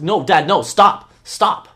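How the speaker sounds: urgent in-character protest; protesting daughter. Acted. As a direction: plead loudly as the daughter being dragged. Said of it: "She's like".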